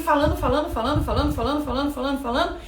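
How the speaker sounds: rapid run of repeats with no pauses; nonstop chatter. Acted. Preacher mimicking someone who prays without stopping, nonstop chatter. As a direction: rattle it off on one even tone.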